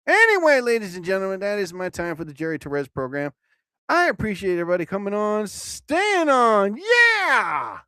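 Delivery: loud, high, fast announcer outro; host's rousing goodbye to the listeners; hyped show sign-off. Real, not acted. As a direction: pump up the audience as you close the show. Signing off the show.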